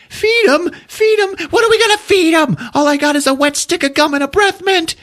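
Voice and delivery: very high frantic squawking; panicked disbelief. Retold or imitated. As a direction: squeal in hammed-up hysteria. Grease Monkey panics incredulously.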